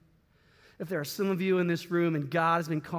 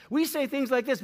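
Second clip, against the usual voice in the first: ordinary talk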